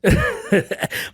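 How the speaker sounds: high, giddy burst of laughter; real giddy laugh; excited laugh. Real, not acted. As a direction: laugh out the thrill. Laughing mid-story to baby mama.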